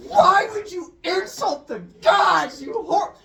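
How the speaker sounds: high, loud, slow acted scream; enraged screaming. Storyteller as goddess attacking the girl, enraged mock screaming. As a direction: shriek a furious scolding, stretching the words. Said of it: "starts screaming".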